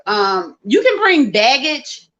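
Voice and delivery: normal talking voice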